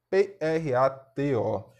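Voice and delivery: "P R A T O" spelled slowly, letter by letter. Shown as a demonstration. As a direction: spell the word out for students. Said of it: "Vamos fazer a separação silábica de prato".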